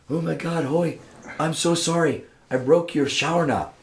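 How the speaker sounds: high-pitched, apologetic mock-British voice; bad British accent. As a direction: imitate a mortified Brit with a poor accent. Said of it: "[name] comes out with his British accent".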